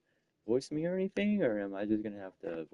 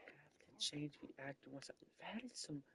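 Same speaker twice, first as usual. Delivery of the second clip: whispering; 4 semitones higher, 20 dB quieter than usual. Real, not acted. Sudden aside under breath.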